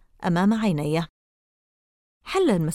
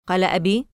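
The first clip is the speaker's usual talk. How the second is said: ordinary delivery, nothing marked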